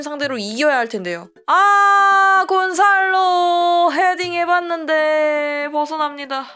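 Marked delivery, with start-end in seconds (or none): 1.5-6.4 high, drawn-out near-miss call; 아쉬운 찬스 중계. Real, not acted. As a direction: cry out at the chance, then stretch it as it goes wide. (길게 끄는 안타까운 중계)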